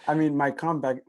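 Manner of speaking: laughing through the words; high laugh spilling into speech. Real, not acted. Laughs at situation.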